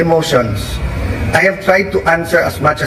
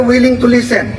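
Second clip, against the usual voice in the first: higher, keen agreement; eager reply; 8 semitones higher than usual. Retold or imitated. Senator quoting his own answer on new taxes, eager high voice. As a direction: say it brightly, ready to help. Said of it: "Sabi ko".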